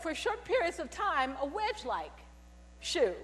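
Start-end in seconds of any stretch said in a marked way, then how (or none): none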